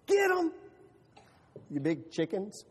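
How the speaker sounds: mock salute from [name]; teasing cheer. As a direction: jeer the late chickens. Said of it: "saluted them as they went by".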